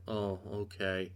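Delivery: soft, slow, let-down shrug; mock meek acceptance, trailing off quiet; deflated oh okay. Homelander giving up after his son's rejection, soft deflated shrug. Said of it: "And Homelander's like".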